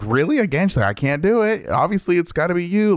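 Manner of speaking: high mock whiny housemate; imitating Emika. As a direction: play it put-upon and whiny. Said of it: "Emika's like".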